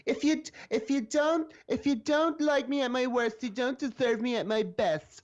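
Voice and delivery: high, slow, airy quote of a slogan; mock the empowerment slogan in a high voice; mocking girly voice. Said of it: "the phrase".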